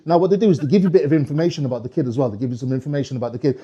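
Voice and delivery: normal talking voice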